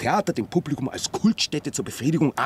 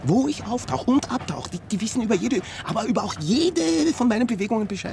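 agitated; 10 semitones higher than usual. Acted. Paranoid rant, pitch up.

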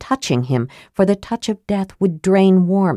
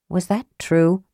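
plain reading voice

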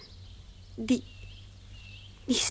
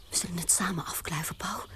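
softer unvoiced invitation; whisper; 6 semitones lower, 7 dB quieter than usual. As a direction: whisper it conspiratorially. (samenzweerderig fluisterend)

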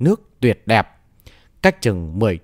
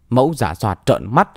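usual speaking voice

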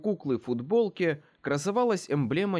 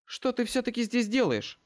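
angry demand; 8 semitones higher than usual. A narrator's reading. Murphy keeps confronting him.

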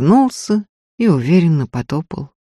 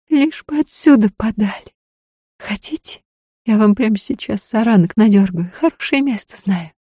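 high, softening, keen-to-help voice; eager peasant woman; 5 semitones higher than usual. A narrator's reading. Woman's figure voice.

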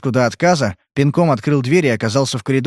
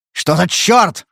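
higher, angry growl; 6 semitones higher, much slower than usual. A narrator's reading. Spade finding a scratch on his hand, growled. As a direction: growl it out on the last word. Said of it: "прорычал он".

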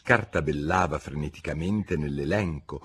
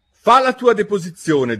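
loud, high royal order; commanding king; 13 semitones higher, 9 dB louder than usual. A narrator's reading. King orders the cook to testify, commanding. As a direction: order the witness like an imperious king.

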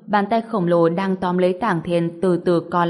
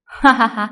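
loud scornful laugh; villain laugh; 4 semitones higher, 5 dB louder than usual. Acted. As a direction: laugh down at him, louder.

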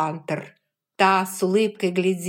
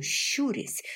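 ordinary delivery, nothing marked

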